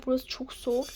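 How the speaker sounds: whisper. Pretend. Kids shivering at snowy door.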